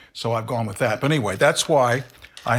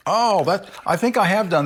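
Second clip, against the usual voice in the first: sudden high, loud oh; delighted surprise at the smell; surprised; 6 semitones higher, 4 dB louder than usual. Real, not acted. Pleasant surprise at flavor.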